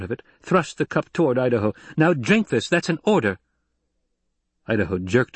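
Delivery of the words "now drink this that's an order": higher, firm order; commanding. Acted. Lady ordering a drunk soldier to drink, commanding. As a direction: give a sharp order that allows no refusal.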